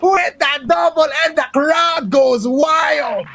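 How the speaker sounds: hype shout. Real, not acted. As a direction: scream it like the crowd just erupted. Caster hypes a clutch play.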